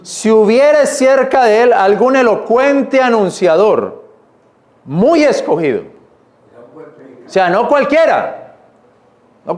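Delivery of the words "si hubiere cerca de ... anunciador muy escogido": slowed, weighty reading of the verse; slow emphatic reread. Shown as a demonstration. Preacher rereads the verse again, slow and weighty. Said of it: "Mira cómo dice ahí nuevamente".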